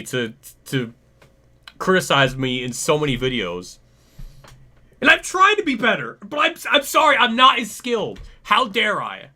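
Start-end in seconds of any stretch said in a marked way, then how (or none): 5.0-9.3 high, loud mock-humble whine; sarcastic mock apology. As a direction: pretend to grovel, dripping sarcasm.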